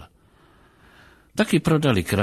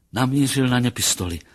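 plain reading voice

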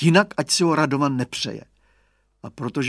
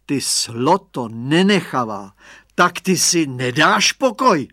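slow, loud, threatening scold; menacing voice; 2 semitones higher, 6 dB louder, slower than usual. Acted. Father catching the glass thief, slow menacing scold. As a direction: growl it out slowly like a furious gardener catching a thief. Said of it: "řekl hrozivým hlasem".

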